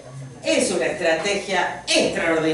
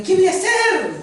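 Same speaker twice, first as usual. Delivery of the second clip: high, loud cry of mock despair; acted desperation; 12 semitones higher, 5 dB louder than usual. God at a loss over stammering Moses, acted desperation. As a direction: throw it out in exasperated desperation. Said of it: "te desesperas".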